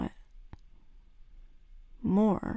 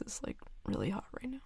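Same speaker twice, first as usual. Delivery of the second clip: flustered whisper; 7 dB quieter than usual. Acted. Flustered blushing.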